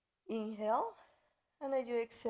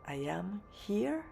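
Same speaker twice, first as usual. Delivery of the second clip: guided meditation. Acted. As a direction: lead them through grounding affirmations, unhurried, leaving room to repeat. Leads a meditation.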